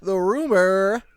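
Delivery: slow unvoiced whisper into the segment; announcer intro. Real, not acted. Host teasing listeners into the rumor roundup, whispered. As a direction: whisper it like a big reveal. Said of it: "announcing the segment".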